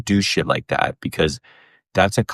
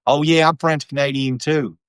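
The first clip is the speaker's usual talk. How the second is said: higher, lightly voiced bro boast; cheerfully clueless bro claim, pitched up; mock Texas bro; 9 semitones higher than usual. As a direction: imitate an eager Texas bro. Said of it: "in Texas, you know, full blown Te".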